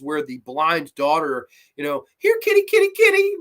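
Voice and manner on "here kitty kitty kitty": high, loud sing-song kitty call; calling the cat. Retold or imitated. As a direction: call sweetly for a lost cat, much higher. Blind daughter calling the cat.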